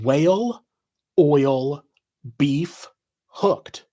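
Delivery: four separate words, a pause after each. Shown as a demonstration. Reading four words off a shirt, word by word. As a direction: read it out as four unconnected words. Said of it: "four words in block letters".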